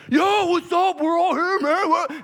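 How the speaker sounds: loud, high bro shout; rowdy college guy. Acted. College guy bursting in with his boys, rowdy. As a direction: announce the crew's arrival at the top of your voice. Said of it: "He walks in with his boys".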